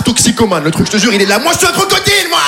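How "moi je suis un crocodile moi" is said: very high cartoon croc voice, proud; voiced boasting crocodile.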